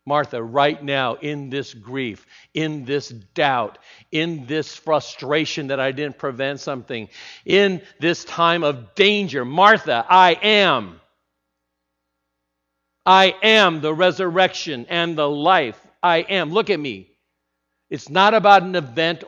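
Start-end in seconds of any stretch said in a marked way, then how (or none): 0.1-7.1 slow measured build. Acted. Preacher builds Jesus's words. 7.4-17.1 louder, higher, swelling proclamation; preached climax. Acted. Jesus proclaiming to Martha, swelling to full voice.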